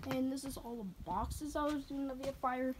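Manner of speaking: ordinary talk, nothing marked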